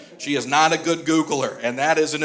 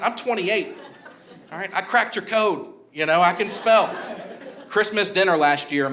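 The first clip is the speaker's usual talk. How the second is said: ordinary talk, nothing marked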